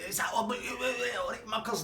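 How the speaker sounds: Swally cheerily greeting the uneasy couple in a high voice; high cheery call. Retold or imitated. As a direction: pitch it up and chirpy.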